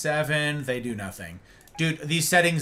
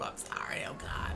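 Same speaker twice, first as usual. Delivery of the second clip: whispered apology; 16 dB quieter than usual. Real, not acted. Aside apology to Derp.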